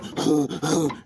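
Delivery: short amused chuckle. Real, not acted. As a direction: laugh it off quickly. Amused chuckle mid-instruction.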